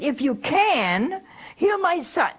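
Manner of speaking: "can" drawn out and stressed, "heal my son" pitched high; emphatic doubtful plea. Retold or imitated. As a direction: retell a plea, leaning slowly on "can". Retold doubting plea, contrasted with humble one.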